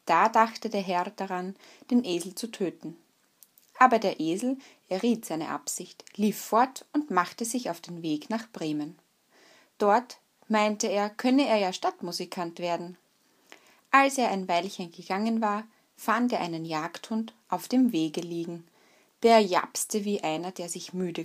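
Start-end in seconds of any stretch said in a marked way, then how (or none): none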